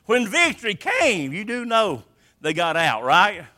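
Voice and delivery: normal talking voice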